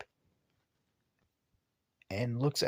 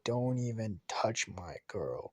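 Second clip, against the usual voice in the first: menacing whisper; 3 semitones lower, 4 dB quieter, slower than usual. Retold or imitated. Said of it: "glare at Lee".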